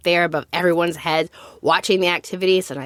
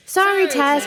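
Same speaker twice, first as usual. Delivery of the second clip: higher, echoing group apology; chorus of voices; 8 semitones higher than usual. A narrator's reading. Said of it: "came a chorus of voices".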